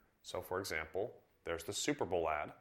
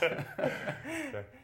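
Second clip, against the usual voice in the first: laughing. Real, not acted. Laughing after recalling dealer ads.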